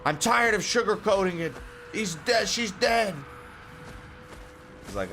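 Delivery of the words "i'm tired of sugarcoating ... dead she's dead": higher, put-on voice of the intense friend; mock anime friend announcing a death; high mock cutscene voice. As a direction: ham up the game character's dramatic reveal. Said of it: "They were like".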